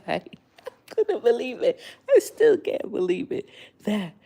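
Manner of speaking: high, laughing through the words; laughing disbelief. Real, not acted. Vlogger laughing at missing the famous YouTuber, high and giggly. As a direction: laugh it out, can't believe it.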